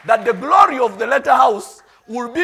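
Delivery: preacher's shout. Acted. Pastor proclaiming the coming glory, full-voiced shout. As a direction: hurl it to the back of the hall.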